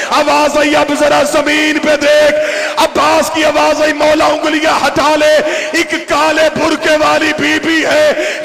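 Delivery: loud, high, slowed-down lament; preaching at full force. Acted. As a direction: cry out every line to the mourners. Climax of the masaib.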